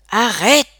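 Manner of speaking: loud, sharp plea to stop; called-out order. A narrator's reading. Gang member Peter stopping his friend, calling out. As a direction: throw the word out loudly. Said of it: "lança Peter".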